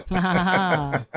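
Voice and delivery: slow gloating evil laugh; mad scientist cackle. Pretend. Evil laugh after star-studded booth.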